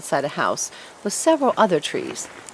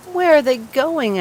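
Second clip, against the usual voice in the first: slow, high, louder question; eager fir tree; 8 semitones higher, 5 dB louder than usual. Acted. Fir tree asking the sparrows, slow and high. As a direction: plead to know, slow and high.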